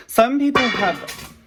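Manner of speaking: "Some people have" stretched slowly, "people" sung up high and falling; a silly drawn-out announcement; goofy overdone pitch swoop; ridiculous drawn-out voice. Real, not acted. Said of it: "sorry, that was ridiculous".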